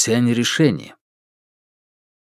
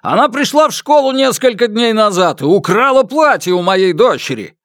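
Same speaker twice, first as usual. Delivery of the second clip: high-pitched furious accusation; angry shouting; 10 semitones higher, 3 dB louder than usual. A narrator's reading. Father accuses the other man's daughter of stealing, angry shouting. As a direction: rail at them in a raised, high voice. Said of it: "закричал Ма Дуньчэнь".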